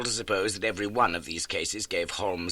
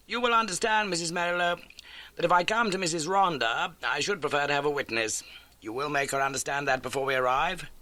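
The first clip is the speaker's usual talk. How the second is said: lightly raised voice for Holmes introducing a guest; a crisp, brisk detective; 8 semitones higher than usual. A narrator's reading. Holmes introduces the landlady to Watson, brisk.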